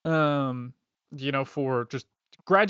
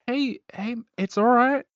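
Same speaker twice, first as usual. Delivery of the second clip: higher, gentle comforting voice; reassuring; 10 semitones higher, 6 dB louder than usual. Retold or imitated. Otto comforts Dashin in a higher, soothing voice. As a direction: soothe a shaken friend. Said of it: "is like".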